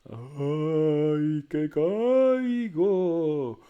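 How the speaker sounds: high, very slow drawn-out moaning; ghostly wail. A narrator's reading. Ghostly voice in the night wails that it's falling. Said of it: "una voz que decía".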